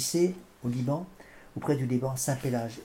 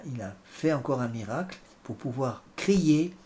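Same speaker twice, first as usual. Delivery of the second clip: normal talking voice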